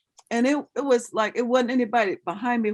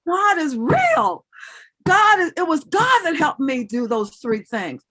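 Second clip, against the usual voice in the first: excited realization; 9 semitones higher, 7 dB louder than usual. Retold or imitated. Realizing God is real.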